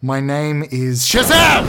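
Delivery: slow, loud heroic cry; heroic shout. Acted. Transforming into a hero, a slow heroic shout. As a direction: bellow the magic word.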